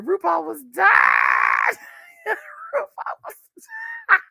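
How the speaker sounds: high-pitched squeal dissolving into laughter; cracking up. Real, not acted. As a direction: shriek it through helpless laughter. Cracking up retelling [name]'s reaction.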